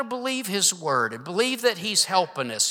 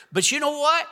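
preaching; 5 semitones higher than usual. Acted. Preaching rises again.

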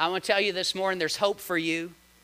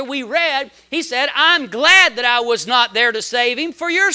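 louder and higher, building; full-force preaching; 5 semitones higher, 6 dB louder than usual. Acted. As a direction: preach it with fire.

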